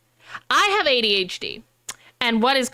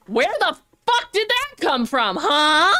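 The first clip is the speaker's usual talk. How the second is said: high, stunned outburst ending in a yelp; shocked reader; 5 semitones higher, slower than usual. Retold or imitated. Reader shocked by a twist, high stunned outburst. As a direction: caught totally off guard by the twist. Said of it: "and you're like".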